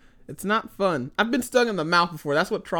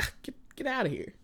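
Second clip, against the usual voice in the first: mock shoo; 10 semitones higher, 8 dB quieter, faster than usual. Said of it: "I'm like".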